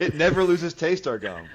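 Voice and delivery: mock ad voice. Joking gum ad voice.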